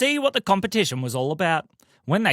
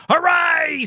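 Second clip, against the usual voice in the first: excited high hooray; high cheer; 12 semitones higher, 3 dB louder than usual. Acted. Cheering car starting.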